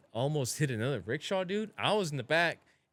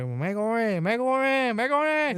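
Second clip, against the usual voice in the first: much higher, louder mock old lady calling megor; 10 semitones higher, 5 dB louder, much slower than usual. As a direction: raise the voice with each megor.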